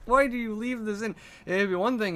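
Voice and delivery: high, fed-up question; exasperated. Acted. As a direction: demand it, exasperated. Reacting to video, ranting.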